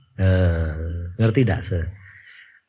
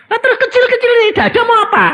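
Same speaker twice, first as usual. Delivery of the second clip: high, loud, very fast son's voice; exasperated son; 7 dB louder, much faster than usual. Retold or imitated. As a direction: rattle it high through laughter. Son's rapid complaint.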